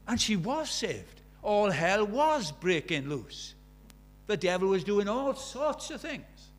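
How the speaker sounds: loud, slow, high proclaiming; full-force preaching. Acted. Preacher telling the congregation about the devil's attack, loud and slow. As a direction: thunder it out from the pulpit. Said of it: "preaching at full force".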